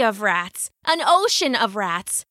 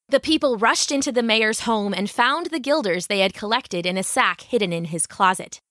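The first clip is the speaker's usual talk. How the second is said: ordinary delivery, nothing marked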